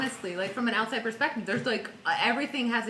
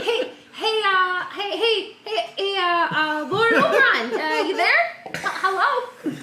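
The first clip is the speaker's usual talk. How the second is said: high, giggly, hesitant calling out; nervous laughing plea; 9 semitones higher, 7 dB louder, slower than usual. Acted. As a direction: timidly call for Lord Oberon, laughing.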